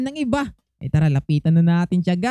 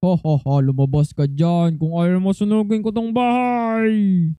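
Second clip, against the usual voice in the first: scary giant. Pretend. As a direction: threaten like a storybook giant, stretching the last word. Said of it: "takutin na lang natin siya". (boses ng higanteng nananakot)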